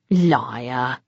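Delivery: calm and almost friendly; pleasantly. A narrator's reading. Bones calmly calling the vampire a liar, pleasant. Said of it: "Pleasantly, from Bones".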